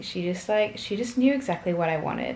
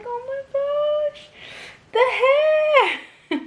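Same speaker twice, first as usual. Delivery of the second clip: delighted squeal; 6 dB louder, slower than usual. Retold or imitated. Reliving feeling baby's hair.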